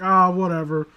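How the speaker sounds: ordinary talk, nothing marked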